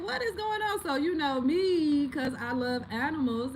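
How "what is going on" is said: very high-pitched retold alarm; alarmed outcry. Owner reliving the pit bull running at Princess, alarmed high cry. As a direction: re-enact startled disbelief, pitched right up. Retelling her alarm at the attack.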